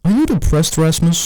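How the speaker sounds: high-pitched imitation of a teacher. Teacher questioning a pupil after class, mock high voice. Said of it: "She goes".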